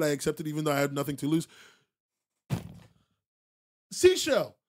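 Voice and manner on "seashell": sharp high call of a name. Real, not acted. Streamer calling out a chatter by name, sharply.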